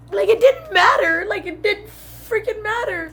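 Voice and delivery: high, hurt voice losing force; tearful frustration. Real, not acted. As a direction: let it slow and sink in volume through tears. Tearful, efforts went unnoticed.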